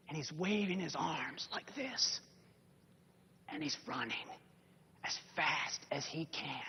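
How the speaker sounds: whispered, very soft storytelling; hushed narration. A narrator's reading. Preacher tells the congregation of the running man, hushed. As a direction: draw the listeners in with a hush.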